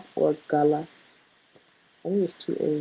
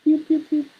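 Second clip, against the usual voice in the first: mock laser sound; 10 semitones higher, faster than usual. Playful sound while typing.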